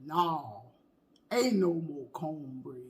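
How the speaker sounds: low, soft, slow deadpan; cornbread lady. Retold or imitated. Imitating the cornbread lady refusing, low and slow. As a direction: drag it out flat like the old lady. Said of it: "That lady that say".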